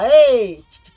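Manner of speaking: loud shout to the bird; shouting to the trapped bird; calling out. Acted. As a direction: call out sharply to get the bird's attention. Fool calls to the bird.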